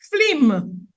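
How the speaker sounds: ordinary talk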